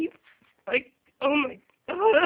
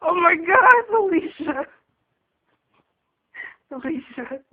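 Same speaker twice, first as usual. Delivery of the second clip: hysterical laugh; 4 semitones higher, 5 dB louder, slower than usual. Real, not acted. Laughing fit.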